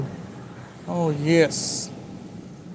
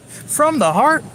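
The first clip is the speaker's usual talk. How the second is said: "From the heart?" pitched much higher with a long rising doubt; mock incredulous; 8 semitones higher, slower than usual. Vlogger mocking the advice, incredulous high rise. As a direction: act out disbelief at the idea.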